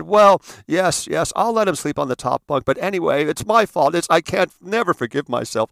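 high, loud self-pitying mom; mother feigning guilt to the doctor, theatrical; melodramatic mother. Retold or imitated. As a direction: wail the confession like a martyr. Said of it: "the story that she tells the doctor".

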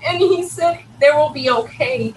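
high, voice strained with emotion; reliving the moment, close to tears; emotional. Real, not acted. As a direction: voice tight and lifted with feeling. Emotional near-death account, voice rising.

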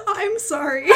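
loud crying laugh; laughing so hard it almost sobs; laughing apology. Real, not acted. Co-host cracking up.

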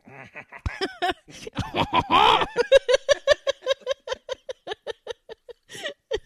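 high, loud mischievous chuckling; naughty French chef laugh. Pretend. Co-host playing chef poisoning someone, naughty laughter. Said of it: "you sound like a naughty French chef".

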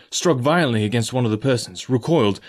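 usual speaking voice

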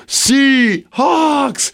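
high, drawn-out chant call; crowd-rousing call. Retold or imitated. Wrestler constantly goading the crowd, high drawn-out rallying call. Retelling his crowd catchphrase.

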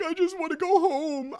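put-on high, loud whiny little boy; whining child. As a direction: imitate a kid whining in protest. Voicing his reluctant young son.

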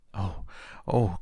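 delighted little oh, rising; cry of delight. A narrator's reading. Boy hearing Dickon will visit, delighted cry. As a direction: let the joy burst out. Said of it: "Colin cried out in delight".

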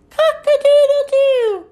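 high, loud crow; high-pitched rooster call. Acted. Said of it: "sound like a rooster".

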